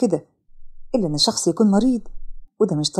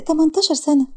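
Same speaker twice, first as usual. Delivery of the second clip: lightly voiced shy girl; 6 semitones higher than usual. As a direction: play the shy tearful girl, timid. Sara voiced high. (بنت خجولة بصوت أعلى وأهدى)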